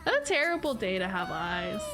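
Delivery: normal talking voice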